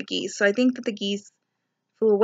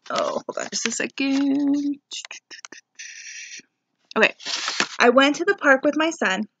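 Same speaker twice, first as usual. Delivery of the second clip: ordinary talk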